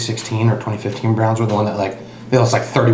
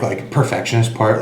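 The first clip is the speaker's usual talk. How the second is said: ordinary talk, nothing marked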